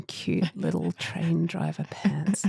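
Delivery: slow, soft, haunted repetition; wounded echo. Retold or imitated. Recalling words seared into her brain, wounded. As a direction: repeat it slowly, softly, as if seared in. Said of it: "like, seared into my brain".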